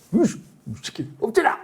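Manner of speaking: high, gruff mock outburst at the kids; replayed fatherly rage; retold angry yell. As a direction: snap at them to get down. Angry father recalls punishing kids.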